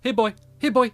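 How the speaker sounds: high coaxing pet voice; calling a dog. Real, not acted.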